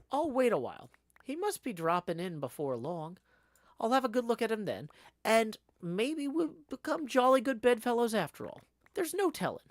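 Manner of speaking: high, lilting acted inner monologue; hopeful storybook narrator. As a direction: talk yourself round, brightly theatrical. Acts the narrator's inner speech.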